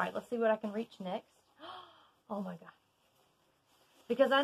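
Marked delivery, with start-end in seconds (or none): 1.6-2.7 hushed gasp, then oh my God; breathless little gasp of surprise. Real, not acted. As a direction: caught off guard by something lovely. Gasps at the next item.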